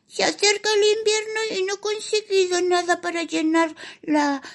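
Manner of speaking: high anxious little mouse pleading; plead, close to tears; worried mouse voice. Acted. Worried mouse begs for food.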